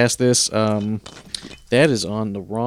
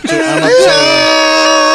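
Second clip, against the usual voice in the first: high pained shriek; 10 dB louder, much slower than usual. Acted. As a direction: scream it out in pain. High shrieking over music.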